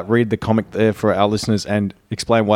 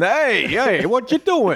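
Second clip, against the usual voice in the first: put-on high-pitched caricature of an American accent; mock American street accent, pitched way up; 12 semitones higher, much slower than usual. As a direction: ham up a cartoonish American accent. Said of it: "jump to an immediate stereotype".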